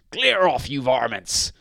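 high, loud telling-off; shouted. A narrator's reading. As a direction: shout them off crossly. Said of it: "shouted Mister Grinling".

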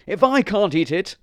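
a high, loud, spiteful dog; selfish dog. Acted.

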